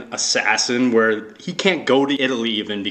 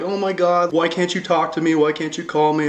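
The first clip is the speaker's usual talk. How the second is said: put-on higher, pleading girlfriend voice; whiny Anna; 6 semitones higher than usual. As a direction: whine the complaint up high. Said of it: "She's like".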